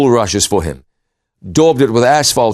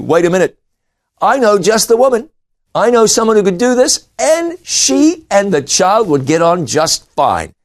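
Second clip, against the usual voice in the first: put-on higher, eager girl's voice; eager sister; 7 semitones higher than usual. Miriam eagerly offering Pharaoh's daughter a nurse, higher voice. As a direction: pitch it up like a keen young girl with an idea.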